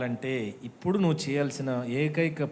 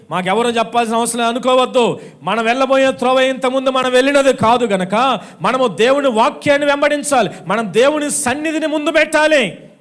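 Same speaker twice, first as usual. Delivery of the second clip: loud, higher fiery preaching; full-force preaching; 7 semitones higher, 10 dB louder than usual. Acted. Pastor urging the congregation, loud fiery preaching. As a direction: preach it out hard to the crowd.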